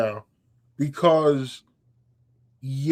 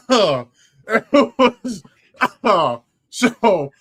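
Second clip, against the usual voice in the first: laughing; 7 semitones higher, 7 dB louder, much slower than usual. Real, not acted. Laughing at shared memory.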